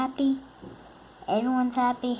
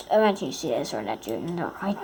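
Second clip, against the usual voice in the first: ordinary talk, nothing marked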